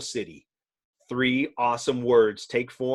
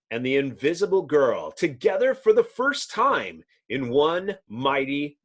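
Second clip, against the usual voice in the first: big announcer-style title read; dramatic reading; 3 semitones higher, 3 dB louder than usual. Acted. Reader opening a comic with dramatic flair. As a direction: boom out the comic's cover like a trailer. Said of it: "the dramatic reading".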